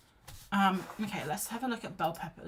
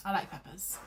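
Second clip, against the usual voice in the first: whisper; 3 dB quieter than usual. Real, not acted. Whispered aside.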